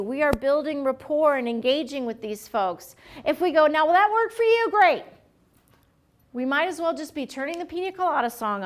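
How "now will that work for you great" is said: rushed clerk. Acted. Clerk not waiting for an answer.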